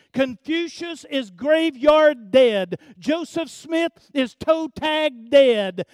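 loud, high, slow declamation; ringing, emphatic sermon climax; full-force preaching. Real, not acted. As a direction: proclaim it to the whole church, stretching the key words.

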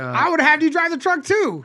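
high, loud mock indignation; loud teasing outburst. Real, not acted. Mock-indignant teasing over the driving.

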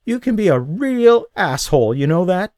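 high, loud, tearful rebuke; angry woman with tears in her eyes; hurt anger. Acted. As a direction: tell him off through tears.

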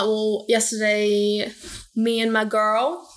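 normal talking voice

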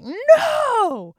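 a high, loud mock shout of No!; shouted refusal. Kings refusing Israel's passage, loud high No!.

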